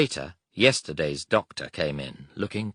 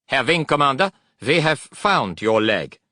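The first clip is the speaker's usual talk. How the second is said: formal German officer; 8 semitones higher, 8 dB louder than usual. Acted. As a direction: announce it like a stiff German officer.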